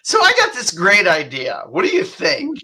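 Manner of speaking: excited pitch. Retold or imitated. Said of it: "she said".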